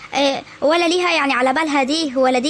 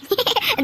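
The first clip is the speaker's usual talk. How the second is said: short, high giggle; amused giggle; 8 semitones higher than usual. Acted. Woman amused by the frightened girl, light high giggle.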